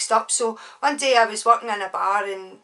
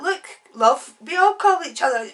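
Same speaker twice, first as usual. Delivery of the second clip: louder, higher mock explanation; man justifying himself; 7 semitones higher, 7 dB louder than usual. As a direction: imitate him pleading his case.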